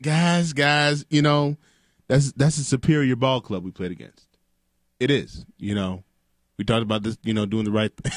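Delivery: hushed coach consoling a beaten team; sad coach locker room talk. Retold or imitated. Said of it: "What do you say to your guys?".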